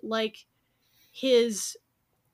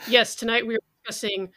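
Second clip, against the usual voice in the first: normal talking voice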